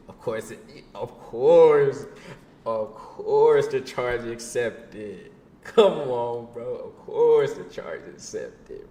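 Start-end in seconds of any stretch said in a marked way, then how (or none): 0.1-5.3 each word stretched out slowly; milk the punchline, dragging every word; slow dramatic drawl. Acted. Telling a friend the call got through, slow drawn-out punchline. 6.7-8.8 each word stretched out slowly; milk the punchline, dragging every word; slow dramatic drawl. Acted. Telling a friend the call got through, slow drawn-out punchline.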